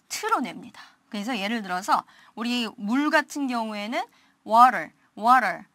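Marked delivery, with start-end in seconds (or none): 4.5-5.6 water with the T melted into a quick d-like tap; American flapped T. Shown as a demonstration. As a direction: stretch out water the American way, T softened almost away. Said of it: "T 발음을 거의 연화시키라고 … 미국식 발음".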